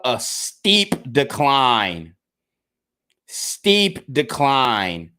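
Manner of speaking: very slow, dragging each word out; slow, heavy repetition. Real, not acted. Vlogger grimly lingering over his channel's steep decline, very slow. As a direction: let the decline sink in, word by word. Vlogger dwelling on falling views.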